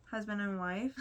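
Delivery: ordinary talk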